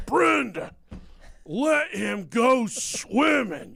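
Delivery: high, slow, drawn-out shout at his wife; dad's shout. Retold or imitated. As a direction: yell it like a fed-up dad. Dad snaps, shouting at wife.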